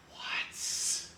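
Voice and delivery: breathy, whispered what; whispered shock. Retold or imitated. Cobra Commander whispering in disbelief at David. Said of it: "And Cobra Commander, like".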